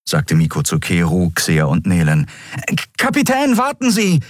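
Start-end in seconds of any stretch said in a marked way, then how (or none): 2.7-4.2 high-pitched, stammered call. A narrator's reading. Miko rushing after the captain, calling out. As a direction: call after someone to wait, high and urgent. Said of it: "rief er kurz darauf".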